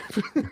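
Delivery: high, sudden laugh; helpless real laugh. Real, not acted. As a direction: burst out laughing against your will. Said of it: "I don't know why I'm laughing".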